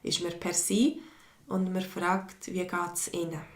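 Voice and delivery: ordinary talk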